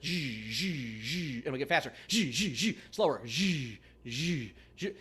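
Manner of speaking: imitated creaking noise. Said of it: "I would hear this noise".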